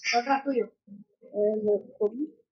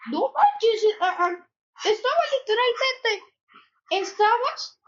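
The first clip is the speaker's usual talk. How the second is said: surprised; 8 semitones higher, 3 dB louder, slower than usual. Real, not acted. Startled by sudden event underground.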